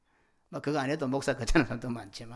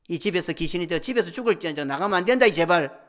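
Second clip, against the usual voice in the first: loud, higher-pitched plea; urgent pleading; 4 semitones higher, 9 dB louder than usual. Acted. God begging his people not to leave, urgent loud plea. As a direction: beg forcefully, building to a louder finish.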